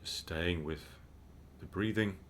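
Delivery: calm, unhurried meditation guide; measured mindfulness instruction with spacious pauses; guided meditation. Real, not acted. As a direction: guide listeners gently into breathing, with long pauses.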